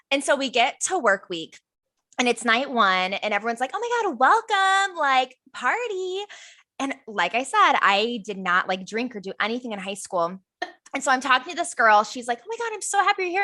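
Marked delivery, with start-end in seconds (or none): 3.7-6.2 high, drawn-out mock party greeting; party welcome. Staff greeting newcomers on night one, mock-excited welcome. 12.4-13.4 high, rapid imitation of gushing; gushing greeting. Recruiter greeting the new girl, imitated gushing.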